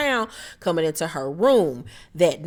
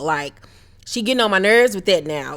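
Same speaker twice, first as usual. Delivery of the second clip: ordinary talk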